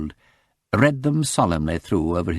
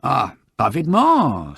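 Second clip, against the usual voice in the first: put-on slow, pleased French-accented agreement; French shop girl; 6 semitones higher, 5 dB louder, slower than usual. Shop girl agreeing to help the detective, French accent. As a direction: savour the word, French accent.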